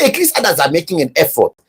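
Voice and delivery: normal talking voice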